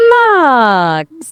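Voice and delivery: long, drawn-out imitated cluck; committed chicken cluck. AI retries the chicken, long drawn-out cluck. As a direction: stretch out one badly faked cluck. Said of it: "This requires commitment".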